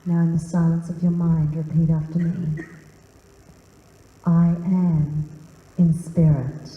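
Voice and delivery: guided meditation. Acted. Guiding the room through deep breathing, low and calm.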